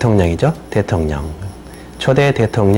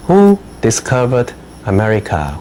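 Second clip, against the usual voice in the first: slow dictation read; much slower than usual. Shown as a demonstration. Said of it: "teacher reads a dictation question".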